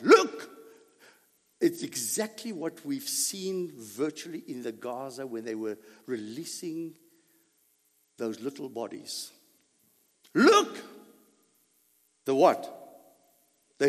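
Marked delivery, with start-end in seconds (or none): 0.0-0.5 higher, louder cry of Look; shout. Retold or imitated. Philistines shouting at the sight of the Hebrews. 10.3-10.8 higher, louder cry of Look; shout. Retold or imitated. Philistines shouting at the sight of the Hebrews.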